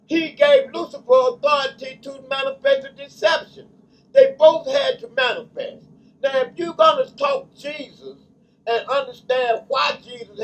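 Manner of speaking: loud, rising preacher's cadence; fervent pulpit delivery building in volume; preaching at full force. Acted. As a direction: preach it out to the congregation. Preaching on truth and lie.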